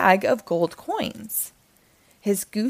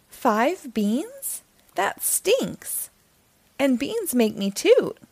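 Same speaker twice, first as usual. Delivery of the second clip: put-on higher, pleading boy's voice; Jack's protest; 6 semitones higher, slower than usual. Jack pleading with the narrator, higher boy voice. Jack complains about beans.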